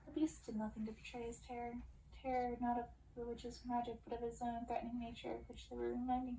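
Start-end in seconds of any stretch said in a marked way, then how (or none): none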